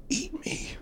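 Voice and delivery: high, very soft whisper; dying wife's whisper. Acted. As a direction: whisper weakly into a husband's ear. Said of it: "puts his ear right next to her lips".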